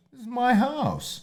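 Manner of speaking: high, slow mock drunk protest; retelling the drunk guest's reply in a high mocking voice; mock drunk guy. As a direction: ham up the indignant drunk.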